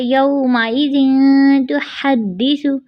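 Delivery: slow recited यौमाइज़िन तुहद्दिसु; Quranic recitation. Shown as a demonstration. Teacher recites the blessed verse for students. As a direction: draw the verse out slowly. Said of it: "हमारी आयत मुबारक है".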